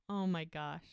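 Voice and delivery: plain reading voice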